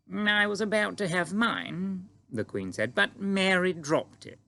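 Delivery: Queen greeting the giant calmly; composed Queen. A narrator's reading. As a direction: stay perfectly poised, royal. Queen voiced in story.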